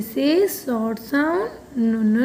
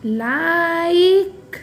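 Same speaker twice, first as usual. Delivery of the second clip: Like with a long stretched vowel; slow teaching model of like; slow read-along; 2 semitones higher, much slower than usual. Shown as a demonstration. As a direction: draw out like for pupils to join in. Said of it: "फिर से एक बार साथ में पढ़िए".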